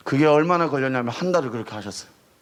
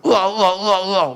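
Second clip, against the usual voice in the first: mock tongues; 4 semitones higher, 5 dB louder than usual. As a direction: drag out a gibberish wail mocking the praying kids. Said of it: "막 이러고".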